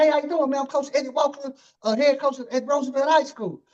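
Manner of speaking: high fast coach voice. Retold or imitated. Host imitating the coach walking up to greet him, high and fast. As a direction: chirp a hello like a wound-up coach. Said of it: "he introduced himself".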